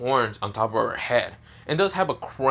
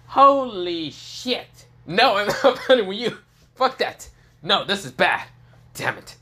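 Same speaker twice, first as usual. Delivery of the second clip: stunned disbelief; 8 semitones higher, 3 dB louder than usual. Acted. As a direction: gasp it out in shock, then back off. Frederick stunned, refuses to fight.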